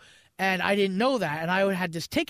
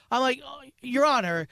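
normal talking voice

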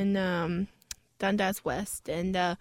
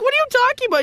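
incredulous; 11 semitones higher, 4 dB louder, much faster than usual. Retold or imitated. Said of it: "I'm like".

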